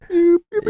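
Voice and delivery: very high electronic beeping noises; robotic scanner beeps. Retold or imitated. Feeling like the Terminator spotting brass knuckles, high beeps. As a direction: mimic a machine locking onto a target.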